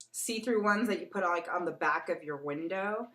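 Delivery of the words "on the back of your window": ordinary talk, nothing marked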